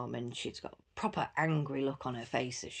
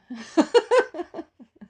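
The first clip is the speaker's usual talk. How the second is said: loud high laugh; 10 dB louder than usual. Real, not acted. Mum laughing at herself, high and loud. Laughing at her own joke.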